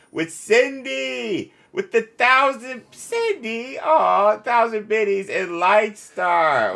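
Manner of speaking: high, drawn-out hype call to chat; gleeful stream shout-out; excited shout-out. Real, not acted. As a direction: sing out the names like a hype man.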